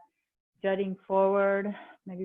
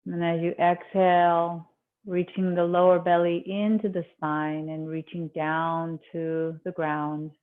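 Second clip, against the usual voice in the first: calm meditation voice, long pauses between phrases; guided meditation; 5 semitones lower than usual. Acted. Yoga teacher easing students into breath awareness, guided meditation. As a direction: settle them gently inward, leaving space after each instruction.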